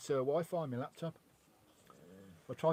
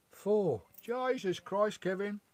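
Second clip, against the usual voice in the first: high, drawn-out disbelief; real shocked surprise; astonished; 7 semitones higher, 4 dB louder, slower than usual. Real, not acted. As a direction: exclaim it in amazement, pitched up. Astonished the boy is four.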